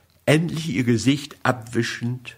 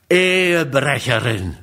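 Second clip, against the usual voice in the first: cried out accusation. A narrator's reading. Husband over the table cries out at his ex-wife in contempt. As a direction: hurl the word at her like an accusation. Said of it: "rief ich".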